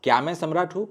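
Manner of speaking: हूँ rising as a question; question tune. Shown as a demonstration. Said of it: "Hindi में एक क्या add होगा".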